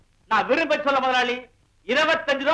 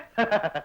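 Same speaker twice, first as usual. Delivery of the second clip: short, lower gloating laugh; boastful laugh; 4 semitones lower, 3 dB quieter than usual. Acted. Soda drinker boasting over the small boy, gloating laugh. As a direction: laugh like the sure winner.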